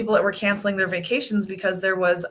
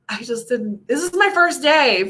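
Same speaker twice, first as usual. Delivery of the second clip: ordinary delivery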